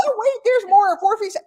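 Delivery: high, loud sales pitch; infomercial pitchman. Pretend. Joking about webinar hosts, mock infomercial pitchman. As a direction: sell it like a late-night ad.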